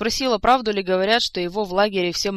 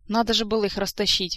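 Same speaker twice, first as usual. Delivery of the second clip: usual speaking voice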